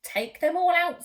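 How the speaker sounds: put-on 1 firm wise old man.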